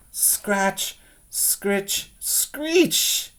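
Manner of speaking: spooky scratching sounds; scratchy noises. A narrator's reading. Sound effects in a story read aloud.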